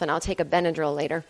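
ordinary talk, nothing marked

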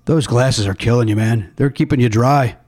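ordinary talk, nothing marked

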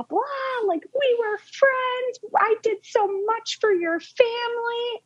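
high sobbing shout, very slow; wailing tantrum at the door; crying tantrum scream. Retold or imitated. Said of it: "screaming bloody murder at me".

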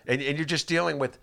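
ordinary talk

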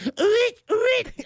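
high squeaky bird call attempt; failed bird call. Shown as a demonstration. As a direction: strain for the bird whoop. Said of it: "I can never".

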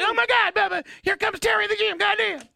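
very high, loud wrestling promo voice. Acted. Imitating a wrestler's promo on request, high-pitched shouting. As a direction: shriek it like a hype-man announcing an entrance. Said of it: "his high-pitched promo cutting voice".

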